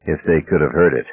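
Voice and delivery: plain reading voice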